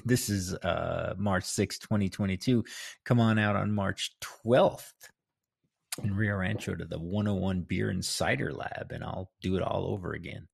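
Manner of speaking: normal talking voice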